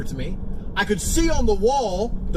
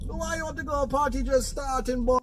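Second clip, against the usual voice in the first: put-on high-pitched Jamaican accent, softer than usual; 7 semitones higher, 4 dB quieter than usual. Drunk friend objects to leaving the party, Jamaican accent. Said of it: "W Andrew Tate's Jamaican accent".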